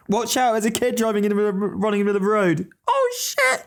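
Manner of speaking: fast, high alarmed cry; panicked warning while driving. Real, not acted. Kid running into road.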